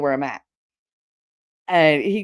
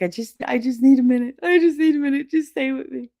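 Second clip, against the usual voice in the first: pleading; 8 semitones higher than usual. Retold or imitated. Fallen mother pleading for a minute.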